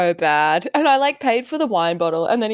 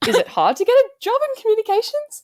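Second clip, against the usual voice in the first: mock innocent high voice; 12 semitones higher than usual. Mock-naive question to awkward date.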